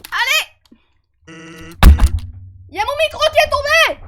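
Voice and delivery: high, loud exasperated cry; frustrated yell. Real, not acted. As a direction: yell in frustration at the fallen mic. Microphone falls, frustrated yell.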